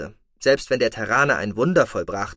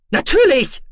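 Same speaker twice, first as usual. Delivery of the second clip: much higher, louder cry; called out; 9 dB louder than usual. Acted. Deputy calling back to his commander, loud and high. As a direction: fire it back at once. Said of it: "rief Zollmond".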